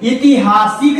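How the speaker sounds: ऐतिहासिक said with an initial short i, "i-ti-haa-sik"; rejected pronunciation. Shown as a demonstration. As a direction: open ऐतिहासिक on "i", loud and high. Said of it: "नहीं होता है".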